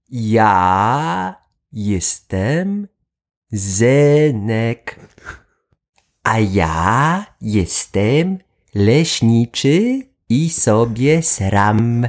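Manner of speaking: very slow, drawn-out words; speaking slowly. Acted. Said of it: "mówcie do niego powoli".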